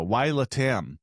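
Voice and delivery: ordinary talk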